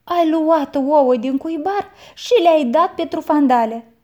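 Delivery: put-on loud, high angry outburst at the boy; aunt catches the boy and snaps at him loudly; snapping aunt. A narrator's reading. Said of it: "se răsti la el mătușa".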